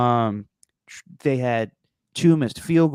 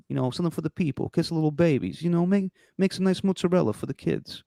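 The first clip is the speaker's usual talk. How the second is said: put-on loosely held Italian accent, storytelling; a lighter Italian accent; 3 semitones higher than usual. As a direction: keep a hint of the Italian accent while telling it. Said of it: "I didn't do my Italian accent".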